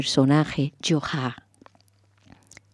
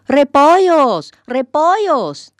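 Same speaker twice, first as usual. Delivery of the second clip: slow, drawn-out street cry, louder; vendor's shout; 5 semitones higher, 5 dB louder, slower than usual. A narrator's reading. Cabbage seller crying his wares in the street, drawn-out shout. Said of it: "Pasó un repollero gritando".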